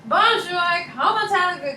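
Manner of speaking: high, chirpy attempt at French accent; enthusiastic bad French. American greeting people in France, enthusiastic bad French accent. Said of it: "when I went to France".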